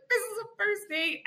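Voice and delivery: high, tearful squeak, retold; near tears. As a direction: imitate pleading on the verge of crying. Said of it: "on the near thing of tears".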